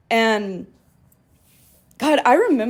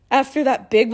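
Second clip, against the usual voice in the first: ordinary talk, nothing marked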